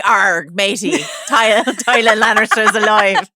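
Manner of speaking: hammed-up pirate growl, laughing through it. Joking about who broke the news, mock pirate voice. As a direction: do a silly stretched-out pirate voice. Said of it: "A pirate was like".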